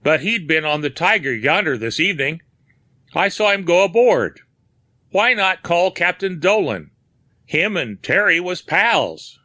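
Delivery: a hint of a helpful onlooker; dock worker. A narrator's reading. Narrator voices the third man.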